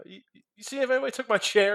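mock LeBron voice. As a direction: rattle it off as a puzzled LeBron. Voicing LeBron in the commercial.

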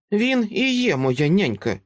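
put-on little girl, high voice, grave and a touch British; a child speaking gravely with a slight British accent; serious, light British accent. Girl on the stairs answers Dresden gravely, light British accent. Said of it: "серйозним голосом і з легким британським акцентом".

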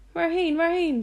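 high, calling-out question; excited fool calling out; loud, insistent fool. A narrator's reading. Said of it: "zei de dwaas luid".

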